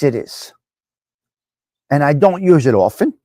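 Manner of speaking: ordinary talk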